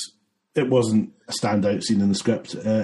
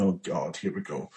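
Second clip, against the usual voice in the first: low, much softer aside; muttered dread; 4 semitones lower, 9 dB quieter than usual. Retold or imitated. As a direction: mutter it under your breath, bracing yourself. Dreading a friend's audition tape.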